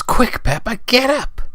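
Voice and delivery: high-pitched hurried urging; urgent call. Acted. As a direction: hurry her out of bed. Chainsaw fireman rousing Peppa.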